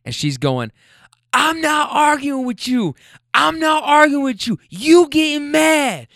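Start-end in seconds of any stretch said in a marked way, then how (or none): 1.3-6.1 high, drawn-out yell imitating an angry woman; imitated screaming. Woman arguing with her man outside the car, imitated screaming. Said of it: "now they're screaming".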